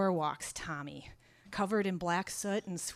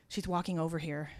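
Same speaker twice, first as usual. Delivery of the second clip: usual speaking voice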